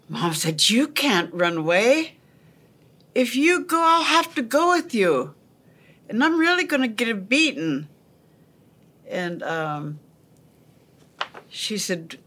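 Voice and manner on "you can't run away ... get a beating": anxious pleading. Retold or imitated. Big sister pleading with runaway sister.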